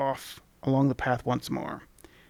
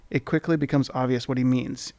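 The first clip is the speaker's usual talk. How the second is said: usual speaking voice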